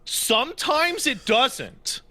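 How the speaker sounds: high exasperated. Real, not acted.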